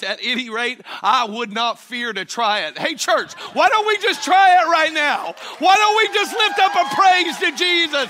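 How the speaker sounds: loud, high preacher's cry to the church; full-force preaching. Acted. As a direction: preach it out, lifting the crowd.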